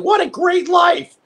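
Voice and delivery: high, slow, dreamy exclamation; excited. Retold or imitated. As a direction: gush about the good life. Quoting his own young thought.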